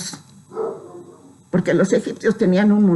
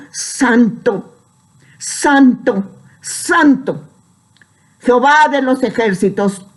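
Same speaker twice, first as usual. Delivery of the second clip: raised, ringing Bible reading in measured phrases; solemn scripture reading; 4 semitones higher than usual. Acted. Preacher reading Isaiah's vision to the brethren, solemn proclamation. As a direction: proclaim each phrase to the congregation. Reads scripture aloud to congregation.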